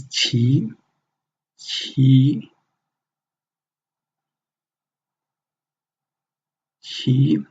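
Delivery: "qi" with a clear second-tone rise; rising-tone "qi" demo. Shown as a demonstration. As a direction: let "qi" climb upward at the end. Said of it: "you probably notice the tone goes up".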